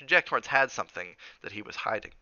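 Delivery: ordinary delivery, nothing marked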